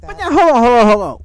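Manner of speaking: high, urgent hold on; excited interruption. Real, not acted. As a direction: cut in fast and high.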